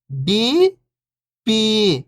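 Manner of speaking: first syllable lifting, pause, second falling. Shown as a demonstration. Teacher breaking a word down for beginning readers, syllable by syllable. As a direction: sound out each syllable alone, long and separated. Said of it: "एक अक्षर का उच्चारण करके".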